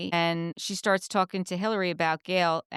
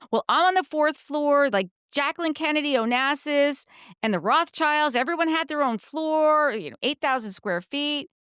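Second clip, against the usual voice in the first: mimicking Gail bragging; 6 semitones higher than usual. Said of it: "she starts".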